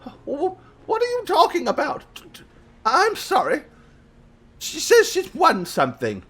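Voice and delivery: high-pitched, stammering grandma; flustered grandmother. Acted. Grandma asking the visitor about winning something, flustered. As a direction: play a confused, apologetic old lady.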